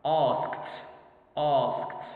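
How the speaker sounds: "Asked" with long aw and a crisp final t; ED pronounced T. Shown as a demonstration. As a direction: model "asked" as one syllable ending in kt. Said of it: "the E D is pronounced T".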